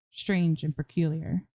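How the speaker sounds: ordinary delivery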